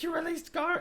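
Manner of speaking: very high squeak of a question; shrieked disbelief. Retold or imitated. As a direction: gasp it out in shocked falsetto. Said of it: "Freya is like".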